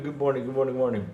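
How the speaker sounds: usual speaking voice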